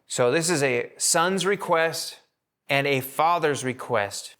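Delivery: usual speaking voice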